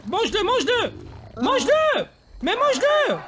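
loud, high urging shout; shouting commands. Real, not acted. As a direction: egg the animal on. Shouting commands at his puma. (ordre crié)

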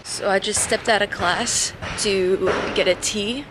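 normal talking voice

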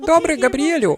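put-on high-pitched little kid; child's voice. Frankie answers dad at the door, child's voice. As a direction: pipe up high like an obedient child.

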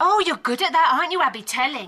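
put-on loud, taunting sneer at the girl; mocking sneer. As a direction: jeer at her for telling tales. Witch sneering at a tattling girl.

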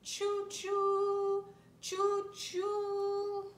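high, sung train whistle; train choo choo. Shown as a demonstration. Reading to a child, whistling like a train. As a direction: sing it like a train. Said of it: "He can go like a train".

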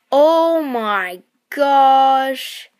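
loud, slow, drawn-out marvel; excited. Real, not acted. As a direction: stretch it out in delight.

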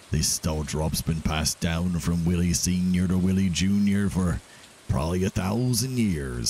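character voice reading a game letter; Willie. Acted. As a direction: keep up the put-on dialect, easing slightly. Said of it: "I gotta read it in Willie's voice".

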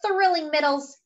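usual speaking voice

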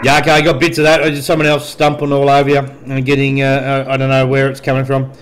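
ordinary delivery

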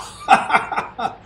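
loud unvoiced cackle; gloating laugh. Commentator gloats after a kill, cackling loudly. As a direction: cackle over the victory.

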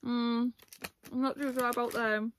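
talking between bites; chewing while talking. Real, not acted. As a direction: mouth partly full. Seen 0: Mouth moving around food after eating crisps from bag.

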